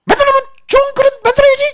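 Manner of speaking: very high squeaky pot voice, whining and complaining; quarreling clay pot. Acted. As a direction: squeal up high like a cracked little pot picking a fight. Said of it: "다투어 싸우는 거야".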